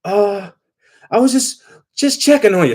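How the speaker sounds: put-on high, pleading old man on voicemail; imitating a lovesick old husband; mock old husband. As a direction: put on a frail, doting old man's voice. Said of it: "he's like".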